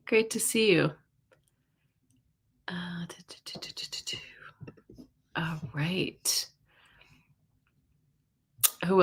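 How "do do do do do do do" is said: whispered do-do-do filler; very soft, unvoiced little doo-doo-doo; absent-minded doo-doo. Real, not acted. Host scanning the chat to herself, absent-minded whispered doo-doos.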